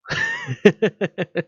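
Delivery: a high, bursting laugh at his own joke; real laugh, amused at himself. Real, not acted.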